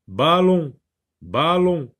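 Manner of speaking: ballon said clearly with a falling end; teacher repeating ballon for listening; sounding out the word. Shown as a demonstration. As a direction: model ballon for children, slow and clear. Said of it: "Eh bien, non".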